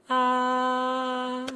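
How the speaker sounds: "Ah." held level and high; flat first tone. Shown as a demonstration. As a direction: sing out "Ah." on one steady high note. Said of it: "the tone that goes kinda like".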